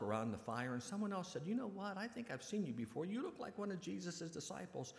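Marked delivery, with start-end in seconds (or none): 1.5-4.9 suspicious bystander. Acted. Bystander accuses Peter by fire.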